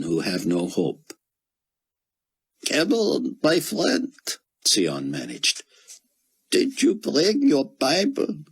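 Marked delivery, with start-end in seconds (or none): 2.7-4.1 much higher, slow, squeezed Israeli voice; a broken scholar clinging to scripture; grieving Israeli scholar. A narrator's reading. Grieving scholar tells Buck his faith, high and squeaked. 6.5-8.4 a broken scholar clinging to scripture; grieving Israeli scholar. A narrator's reading. Grieving scholar tells Buck his faith, high and squeaked.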